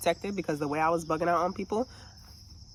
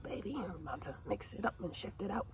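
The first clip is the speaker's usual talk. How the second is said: hushed aside; 2 semitones higher, 13 dB quieter than usual. Real, not acted.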